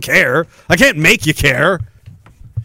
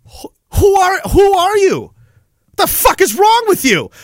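outraged yelling; 10 semitones higher than usual. Retold or imitated. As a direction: call out the crowd for stepping over him. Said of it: "And it's like".

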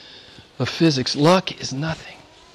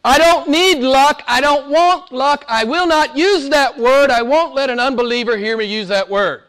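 high-pitched, emphatic pulpit vow; forceful preaching; 10 semitones higher than usual. Real, not acted. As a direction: declare it insistently to the congregation, pitched high.

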